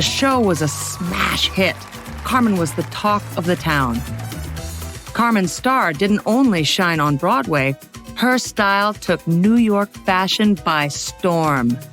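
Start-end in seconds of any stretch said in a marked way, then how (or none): none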